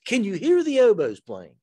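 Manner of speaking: mocking the professor. Mimicking the young passionate music professor, mock gushing. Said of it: "he would always go".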